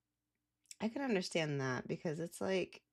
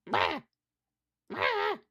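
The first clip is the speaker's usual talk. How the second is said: sharp high squawks, mimicking a bird; 13 semitones higher, 6 dB louder than usual. Birds crowding her lunch, a high squawked bah. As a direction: squawk like an aggressive pigeon. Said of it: "they came up to me, just like".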